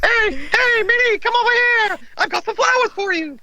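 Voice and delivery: high falsetto Mickey voice; Mickey sweet-talking Minnie in falsetto; Mickey Mouse. Acted. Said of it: "it's the right pitch".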